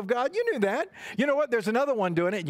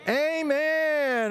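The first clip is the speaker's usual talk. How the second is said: long, held, raised Amen; drawn-out Amen; 5 semitones higher than usual. Real, not acted. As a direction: call out a stretched Amen together. Leads children in closing Amen.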